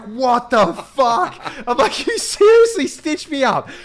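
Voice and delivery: retold outrage. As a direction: replay the fury at being stitched up, laughing through it. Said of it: "I was so mad".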